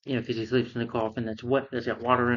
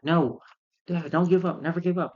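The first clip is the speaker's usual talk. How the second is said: higher, urgent plea to a father; pleading cry; 6 semitones higher than usual. Retold or imitated. Boy yells to his boxer dad in the ring, pleading. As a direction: beg him not to quit, voice rising. Son urges father mid-fight.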